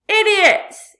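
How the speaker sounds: very loud, high yell. A narrator's reading. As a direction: yell across the sky. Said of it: "he yelled".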